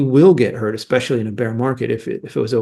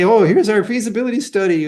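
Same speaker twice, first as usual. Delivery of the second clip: higher mock announcement; imitating a company pitch; 7 semitones higher than usual. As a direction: lightly mimic a company presenting its study. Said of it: "and say".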